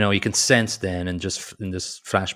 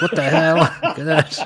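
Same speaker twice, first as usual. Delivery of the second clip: laughing, higher and louder outburst; mock disbelief with a laugh; exasperated what the hell; 9 semitones higher, 7 dB louder than usual. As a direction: blurt it out, half laughing at the absurdity. Mock frustration at unclosable pop-up.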